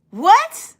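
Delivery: high incredulous what; shocked what. Retold or imitated. Retelling the attack, stunned high what. Her reaction to the juice attack.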